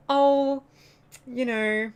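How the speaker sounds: lightly mocking Australian news voice; parodying a dull news report; mock newsreader. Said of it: "Whereas Australia's like".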